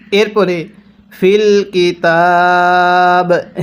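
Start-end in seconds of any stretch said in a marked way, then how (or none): 1.2-3.6 slow, drawn-out tajweed chanting; slow Quran recitation. Shown as a demonstration. Teacher demonstrating recitation to students, slow chant. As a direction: recite each syllable long and measured. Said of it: "কিভাবে পড়ব?".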